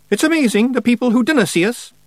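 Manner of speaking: high, quick Scottish pixie voice; cheeky little Scots warrior; Scottish Feegle voice. A narrator's reading. Rob Anybody boasts.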